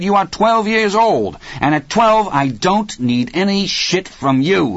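high, forceful tirade building in pitch; angry tirade. A narrator's reading. Hal slamming Dennis with each phrase, angry tirade. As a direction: punch out each phrase, voice climbing.

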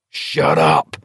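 ordinary delivery, nothing marked

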